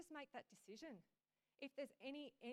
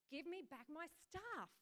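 slow, higher, insistent demand; determined demand; 6 semitones higher, 3 dB louder, slower than usual. Acted. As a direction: press it home with determination. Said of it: "with determination in his eyes".

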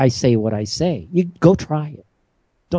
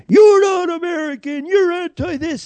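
retold angry shout; 14 semitones higher than usual. Protester yelling at the speaker on the street, high mock shout. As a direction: imitate the protesters shouting him down, pitched way up. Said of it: "And they're like".

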